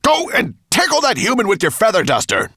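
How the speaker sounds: loud, high-pitched monster growl; growled order. A narrator's reading. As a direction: growl a loud command at your servant. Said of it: "growled Nettlebrand".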